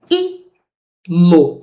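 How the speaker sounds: "I-lo" as two isolated beats; separated syllables. Shown as a demonstration. Teacher separates the hiatus for students, "I-lo" syllable by syllable. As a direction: hold "I" alone, then say "lo". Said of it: "Como é que você separa?".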